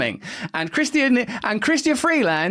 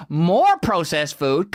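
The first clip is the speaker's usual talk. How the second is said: normal talking voice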